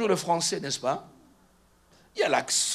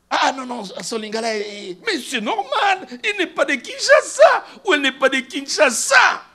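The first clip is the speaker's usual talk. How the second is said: high, loud, drawn-out mock protest; mock exclaiming; 8 semitones higher, 11 dB louder than usual. Preacher acting out excuses for someone's different Lingala, hammed-up exclaiming. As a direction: stretch it out in a hammed-up high shout. Acts out objection to others' Lingala.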